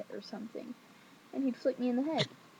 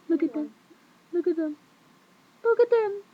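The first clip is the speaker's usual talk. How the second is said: higher, louder each time, building to a squeal; playful escalating "look at them"; insistent showing off; 7 semitones higher, 9 dB louder, much faster than usual. Pretend. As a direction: demand attention, rising to a high shout. Showing off nails, insisting on attention.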